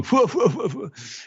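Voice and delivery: high puffing "fwa" laugh; imitated copycat cartoon laugh; crew's silly laugh. Host as the crew continuing the mimicry, high mock laugh. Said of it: "And they're going".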